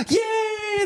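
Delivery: very high excited yay; squealed yay. Retold or imitated. Actress celebrating too early, very high squeal. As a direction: cheer like the kid just made it. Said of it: "jumps the gun and is like".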